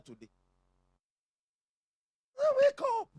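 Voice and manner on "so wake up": very high, loud wake-up cry; rousing shout. Real, not acted. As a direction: jolt the whole church awake. Preacher rousing the congregation.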